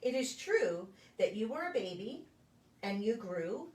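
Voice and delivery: gentle, sing-song picture-book reading with a lilting refrain; reading to children. Acted. Preschool story time reader to the children, gentle storybook voice. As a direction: read it like story time, drawing the little ones in.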